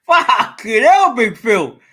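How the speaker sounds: loud, slow, drawn-out curse; exasperated shout. Real, not acted. Reacting to Big Phil's verdict, a slow loud exasperated shout. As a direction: let it burst out in disbelief. Outburst at a friend's remark.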